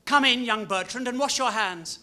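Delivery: put-on stern nurse summoning the child to wash up; nurse calling in. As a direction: call the boy in from the doorway, arms folded. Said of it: "His nurse is calling".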